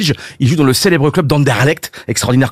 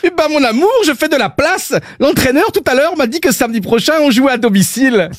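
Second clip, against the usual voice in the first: higher, mock Belgian accent, husband calmly explaining; 7 semitones higher than usual. Footballer explaining to his wife why he is clearing the flat, in a higher mock Belgian accent. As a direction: play the Belgian footballer, matter-of-fact. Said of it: "il est pas mal, mon accent belge".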